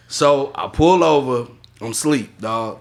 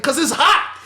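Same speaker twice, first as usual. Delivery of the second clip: high mock yell, half laughing; retold yell; 9 semitones higher than usual. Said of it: "I just yelled at him".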